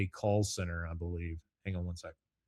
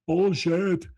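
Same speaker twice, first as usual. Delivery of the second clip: scoffing; 9 semitones higher, 6 dB louder than usual. Real, not acted. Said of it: "reacting to epidural claim".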